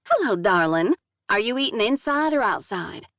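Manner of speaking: lightly voiced Southern hostess; thick southern drawl. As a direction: greet a stranger like a Southern waitress. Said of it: "the thick southern drawl".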